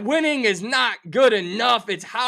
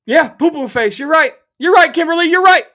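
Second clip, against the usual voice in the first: higher and louder, laughing it off at the co-host; loud teasing retort; 8 semitones higher, 9 dB louder than usual. Real, not acted. Host shouting back at co-host Kimberly, loud mock concession.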